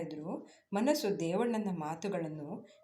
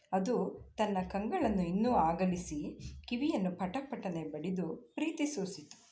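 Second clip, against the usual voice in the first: plain reading voice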